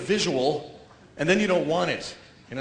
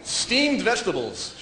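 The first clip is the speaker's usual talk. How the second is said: normal talking voice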